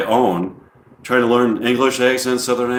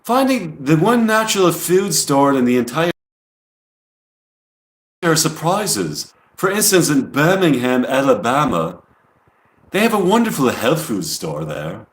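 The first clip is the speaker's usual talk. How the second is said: higher-pitched imitation English accent; 4 semitones higher than usual. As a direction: voice the quote as an English speaker. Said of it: "I'll say it with an English accent".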